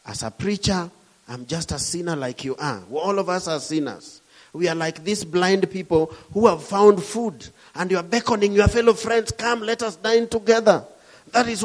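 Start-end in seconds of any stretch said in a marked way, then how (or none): none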